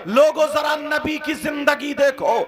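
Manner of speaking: loud chanting sermon with a half-sung lament; preaching at full force. Acted. Preacher chanting Hafsa's plea and the Prophet's reply to the crowd, loud and sung. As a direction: ring it out to the whole gathering.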